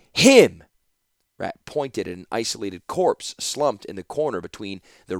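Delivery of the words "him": loud, high cry; sudden anguished shout; distraught shout. A narrator's reading. Distraught man points at corpse.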